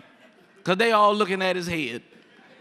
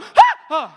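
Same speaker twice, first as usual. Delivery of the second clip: high, loud gasp of fright; mock shriek of breath on waking; startled gasp; 9 dB louder than usual. Boy wakes beside the dead woman, gasping in fright.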